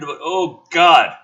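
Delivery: ordinary talk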